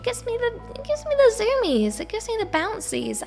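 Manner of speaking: excited. Real, not acted. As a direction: bounce up with giddy energy. Describing caffeine jitters.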